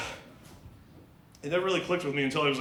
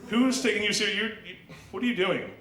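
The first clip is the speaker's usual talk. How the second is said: disgusted reaction; 6 semitones higher, 3 dB louder than usual. Retold or imitated. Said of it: "I'm like".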